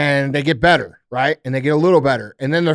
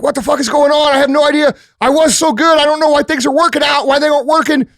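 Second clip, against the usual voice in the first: bewildered outburst; 8 semitones higher than usual. Retold or imitated. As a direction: act lost and confused about what went wrong. Confused quote of failing people.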